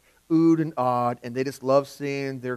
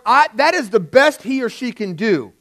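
high, louder proud praise; proud parent; 8 semitones higher, 7 dB louder than usual. Retold or imitated. Parent proud of their own child's drawing, excited. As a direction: beam with pride at your own kid. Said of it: "if it's your child".

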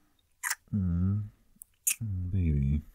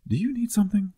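curt, much higher-pitched brush-off; kinda rude. Acted. To girlfriend interrupting his work, a curt rude brush-off. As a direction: snap it out like being bothered. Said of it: "That actually did sound kinda rude".

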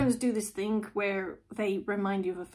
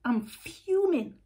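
mock fuming; 9 semitones higher than usual. Tarot reader mocking her own bad mood, hammed-up fuming. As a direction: put on a mock outrage, dragging it out. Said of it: "I've never done a reading when I'm like".

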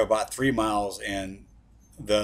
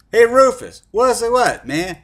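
much higher, louder hammed-up voice of himself calling to his friend; own younger self; 14 semitones higher, 12 dB louder than usual. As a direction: play yourself back then, loud and high. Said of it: "and I'm gonna do my voice".

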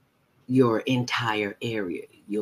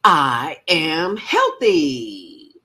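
slow, drawn-out, louder declaration; joyful big affirmation; like you won the lottery; 6 semitones higher, 8 dB louder, much slower than usual. Shown as a demonstration. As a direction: proclaim it with total conviction. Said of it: "say it like you mean it".

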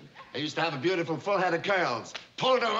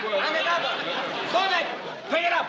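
barked orders; 10 semitones higher, faster than usual. Acted. Boss hurrying a banker over sloppiness, barked orders. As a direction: bark each order as a sharp burst.

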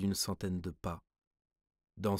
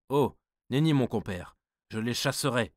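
sudden louder cry of refusal; the king cries out he'll chase them; exclaiming king; 3 semitones higher, 7 dB louder than usual. A narrator's reading. King protests to his counsellor, exclaiming. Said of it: "s'écria le roi".

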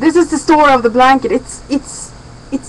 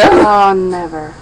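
slow, drawn-out outraged gasp; shocked; 7 semitones higher, 5 dB louder, much slower than usual. Real, not acted. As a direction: draw it out in disbelief. Shocked dad would toss blanket.

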